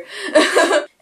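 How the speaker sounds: a quick high burst of laughter; bright laugh. Real, not acted. Laughing to the camera about waking up late. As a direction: laugh at yourself, short and high. Laughs at own early filming.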